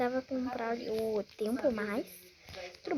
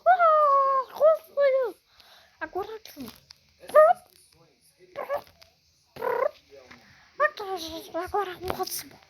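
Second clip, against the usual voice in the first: strange throat voice; 6 semitones higher, 4 dB louder than usual. Pretend. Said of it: "vou ficar com essa voz estranha aqui".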